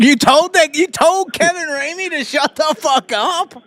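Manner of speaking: loud, high-pitched incredulous question; real amazement. Real, not acted. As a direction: blurt it out in loud disbelief. Listener amazed at the confrontation.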